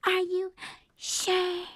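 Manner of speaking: high, coy fake surprise; mocking gold digger. A woman mocks a gold digger's coy reply, high and sweet. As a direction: play innocent in a high voice. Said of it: "motherfucker's like".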